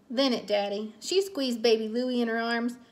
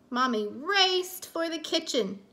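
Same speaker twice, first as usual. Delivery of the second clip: plain reading voice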